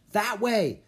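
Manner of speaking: loud, high directions; kid's shout. A narrator's reading. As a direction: shout it across the park. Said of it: "shouted one of the bigger kids".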